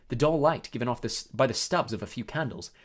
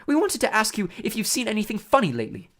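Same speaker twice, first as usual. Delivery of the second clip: hurried, higher interjection; quick; 8 semitones higher, 4 dB louder than usual. A narrator's reading. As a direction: jump in hastily to change course. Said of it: "said Hermione quickly".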